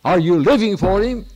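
put-on a preacher confronting the girl; stern pressing question. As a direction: press her hard for the truth. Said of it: "I said".

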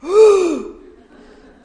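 sharp, shocked gasp, high and loud. Retold or imitated. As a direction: gasp in horror at the mess. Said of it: "I'd be like".

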